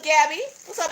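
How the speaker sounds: ordinary talk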